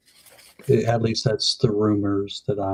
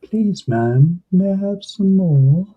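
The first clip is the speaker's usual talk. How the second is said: hammed-up beggar, high and loud; mock pleading; 8 semitones higher, 8 dB louder than usual. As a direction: plead like a hungry orphan, for laughs. Joins in the mock begging.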